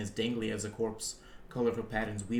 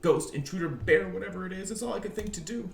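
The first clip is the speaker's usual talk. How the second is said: frightened; 8 semitones higher, 3 dB louder than usual. Acted. Frightened protagonist calling out.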